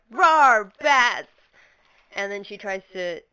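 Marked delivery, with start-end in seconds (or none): 0.1-1.3 loud hammed-up roar; mock roar. Gideon unleashing bats, loud mock roar. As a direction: bellow it like a monster. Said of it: "And she's just like".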